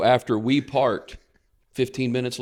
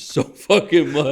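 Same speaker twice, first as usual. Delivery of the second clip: louder, slow and drawn out; emphatic; 4 semitones higher, 7 dB louder, slower than usual. Real, not acted. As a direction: lean hard on every word. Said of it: "emphatic declaration of love".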